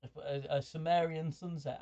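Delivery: ordinary talk, nothing marked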